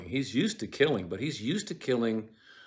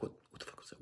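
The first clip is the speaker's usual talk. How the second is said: frightened unvoiced whisper; Lady Macbeth startled, whispering; nervous whisper; 18 dB quieter, much faster than usual. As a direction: hiss it in alarm at the noise. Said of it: "And she's like".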